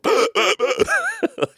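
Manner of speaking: repeated high gasps for air; imitated breath-catching. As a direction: act out catching your breath in sleep. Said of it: "catching my breath".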